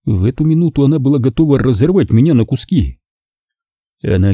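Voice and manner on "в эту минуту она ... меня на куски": usual speaking voice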